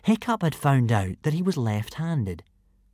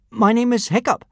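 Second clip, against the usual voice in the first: stammering; 9 semitones higher, 4 dB louder than usual. A narrator's reading. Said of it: "stammered Hiccup".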